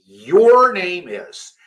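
slow, careful your with a clear R; slow teacher demo; slow model phrase. Shown as a demonstration. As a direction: model it slowly. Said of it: "teacher models slowly".

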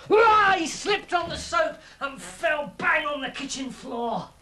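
high yelp into dramatic telling; high-pitched slapstick storytelling; excited narration. A narrator's reading. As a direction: burst out at the sudden slip. Fat Tulip slips over.

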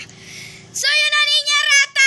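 high, slow, full-volume shout. Pretend. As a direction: belt it out the window as loud as you can. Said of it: "vamos a gritar eso". (grito fuerte y agudo por la ventana)

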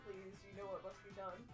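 normal talking voice

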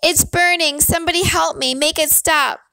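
high, agonized pleading; tearful wail for relief; pained, tearful. A narrator's reading. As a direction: beg through pain in a high voice. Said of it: "pain and tears in the voice".

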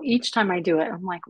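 ordinary talk

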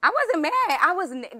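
high, loud protest; sharp defensive denial. Real, not acted. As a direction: insist loudly you weren't mad. Defending herself in argument.

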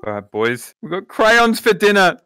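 normal talking voice

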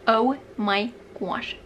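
normal talking voice

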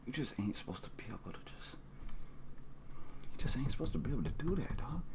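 fast, hushed disbelief; stunned whisper. Real, not acted. Listener to himself on impossible skill, stunned whisper. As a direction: whisper it as if dumbstruck.

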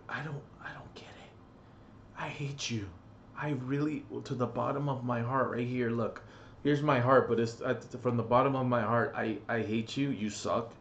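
soft, whispered mock sincerity; hushed hateful confession. Real, not acted. Gamer whispering his hatred to bad players, soft and mock sincere. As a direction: breathe it from the heart.

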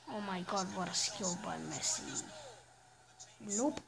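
ordinary talk

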